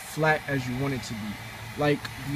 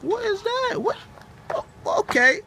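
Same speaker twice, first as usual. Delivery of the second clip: high, startled questions; sudden alarm, voice jumping high; surprised; 3 dB louder than usual. Real, not acted. Startled by something.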